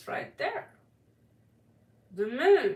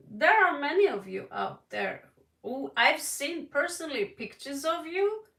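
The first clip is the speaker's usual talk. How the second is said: ordinary delivery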